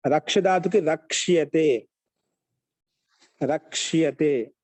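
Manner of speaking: slow, firm, stretched-out syllables; slow dictation. Shown as a demonstration. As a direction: dictate slowly and clearly for note-takers. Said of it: "స్లోగా చెప్పరా". (నెమ్మదిగా విడదీసి పలకడం)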